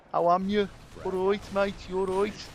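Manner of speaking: cheery Brummie mate voice; Brummie accent. Pretend. Greeting a listener in a Brummie accent. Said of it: "Oh, that was a Brummie accent".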